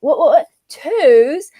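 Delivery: exaggerated posh "Toes?"; baffled question in an imitated posh accent; really posh accent. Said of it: "saying it with a really posh accent".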